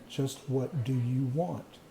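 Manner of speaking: slow, deliberate question from the inner voice. Retold or imitated. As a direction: spell it out slowly, word by word. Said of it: "And the voice said".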